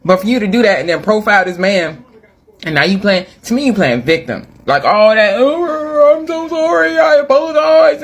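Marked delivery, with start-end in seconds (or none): 5.4-8.0 high, mocking fake-sorry voice; mock apology. Mimicking the woman's apology video, high mocking sorry. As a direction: ham up her insincere apology, pitched high.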